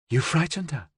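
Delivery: high, understated accusation; accusing. A narrator's reading. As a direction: reproach him for scaring her. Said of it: "Jezebella accused".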